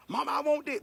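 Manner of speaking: a hint of a child begging mama; pestering child. Child harassing mama in the store, mildly pestering. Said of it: "how children harass their mamas".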